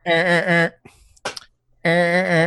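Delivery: short, high repeated creature squawks; high creature grunts. Acted. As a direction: imitate a struggling flying mount, high-pitched. Imitates Buttercup struggling to fly.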